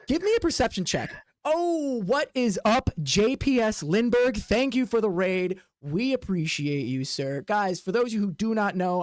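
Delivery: ordinary talk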